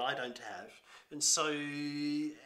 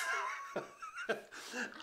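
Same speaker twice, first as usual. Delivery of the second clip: high laugh; 5 dB quieter than usual. Real, not acted. Laughing at own pub story.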